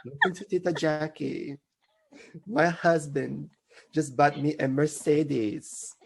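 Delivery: put-on Filipino auntie accent, playful. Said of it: "You do a Filipino accent better than me".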